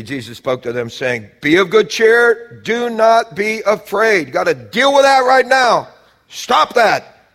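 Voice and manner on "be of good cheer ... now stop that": loud, high, driving sermon climax; full-force preaching. Acted. Preacher urging the church to cleanse themselves, loud and high. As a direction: preach it out hard to the congregation.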